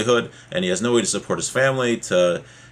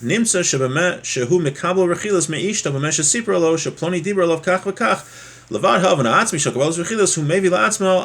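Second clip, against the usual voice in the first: higher, steady recitation of the Hebrew text; reading the source aloud; 5 semitones higher than usual. Acted. Teacher reading the Hebrew passage to students, higher recitation. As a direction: chant through the passage, lifted and even.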